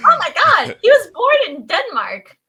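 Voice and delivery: loud, high-pitched real amazement; excited outburst. Real, not acted. Podcaster reacts to co-host's random-people remark, excited outburst. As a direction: burst out, thrilled by the coincidence.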